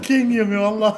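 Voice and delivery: calling out through loud laughter; laughing. Real, not acted. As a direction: shout it while still cracking up. Laughing after joke about name.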